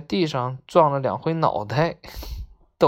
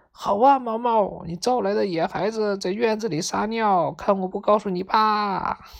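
higher, mock-threatening call; imitated tattling shout; 6 semitones higher than usual. A narrator's reading. Friend imitating the parrot threatening Maomao, tattling call. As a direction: mimic a scolding tattletale, pitched up. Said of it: "总得喊".

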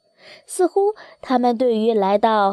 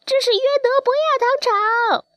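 very high goose cry; wild geese calling. A narrator's reading. Wild geese naming the sugar mill as they fly over, high call. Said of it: "大雁们叫道".

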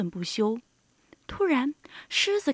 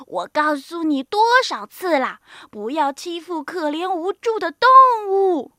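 loud, raised-pitch scolding; exasperated mother's rebuke; old woman shouting; 3 semitones higher, 8 dB louder than usual. A narrator's reading. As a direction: tell off a bullying son. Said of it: "老妇人喊道".

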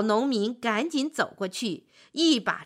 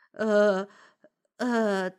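hesitant, stalling "uh" sounds; halting, tongue-tied hesitation; stammering. A narrator's reading. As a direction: stall nervously, lost for words. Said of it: "说话结结巴巴".

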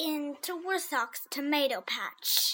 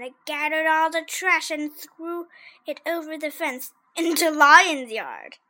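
usual speaking voice

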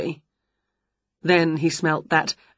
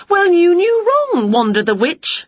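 indignant retort; 12 semitones higher, 5 dB louder than usual. A narrator's reading. Winnie answers back angrily.

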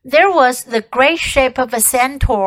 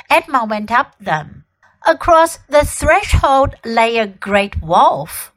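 ordinary delivery

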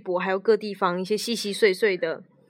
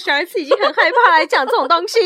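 high, loud dismayed cry; mock-horrified shriek; shocked outcry; 10 semitones higher, 8 dB louder than usual. Real, not acted. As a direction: exclaim in horror at the mother. Reacting to scary mother.

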